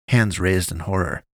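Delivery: plain reading voice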